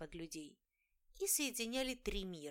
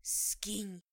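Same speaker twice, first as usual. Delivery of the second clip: hissing. Acted. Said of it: "опять зашипела кошка".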